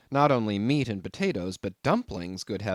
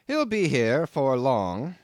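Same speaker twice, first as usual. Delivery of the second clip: louder, slow knowing promise; ominous landlord; 3 semitones higher, 5 dB louder, slower than usual. A narrator's reading. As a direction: tease him with the wait. Landlord's answer.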